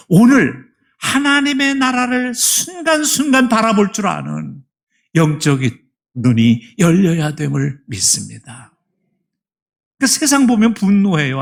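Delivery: loud, high, rising sermon voice; fiery preaching. Acted. Pastor urging the congregation toward heaven, fiery preaching. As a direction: press the question on the congregation.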